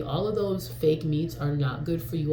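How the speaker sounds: ordinary delivery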